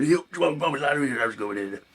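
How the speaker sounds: put-on Yorkshire greeting, starting high; Yorkshire accent. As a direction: imitate a chummy Yorkshire presenter. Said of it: "the Yorkshire accent".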